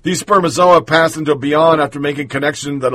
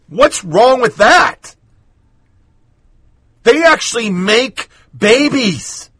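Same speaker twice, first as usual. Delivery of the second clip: slow, higher incredulous question; indignant; 6 semitones higher, slower than usual. Acted. Host challenging the columnist, slow indignant question. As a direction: demand an answer, slowly.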